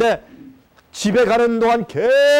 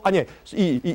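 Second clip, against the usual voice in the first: ordinary talk, nothing marked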